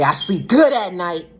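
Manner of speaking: loud, high, drawn-out mimicry; mocking quote. Quoting a parent who put their child out, mockingly. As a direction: throw the boast back in mock disbelief.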